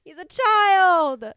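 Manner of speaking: slow, loud, outraged protest; outraged shout. Real, not acted. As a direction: drag it out in loud disgust. Outraged reaction to hotter Tanjiro.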